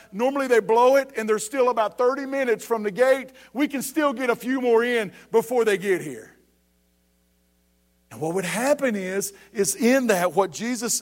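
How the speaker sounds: high, emphatic preacher driving the warning home; urgent preaching. Real, not acted. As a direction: preach a warning to the whole congregation.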